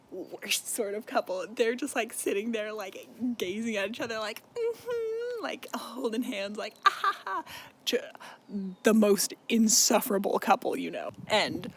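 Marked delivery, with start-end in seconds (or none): 4.5-5.4 high-pitched mock-swooning hum; lovesick hum. Mocking a couple gazing at each other, lovesick high hum. 6.8-7.4 high, put-on lovey-dovey giggle; giddy mock laugh. Mocking a couple holding hands, giddy high fake laugh.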